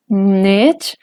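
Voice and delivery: "nech" rising from a low start. Shown as a demonstration. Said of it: "Si tenemos esta secuencia dentro de una palabra".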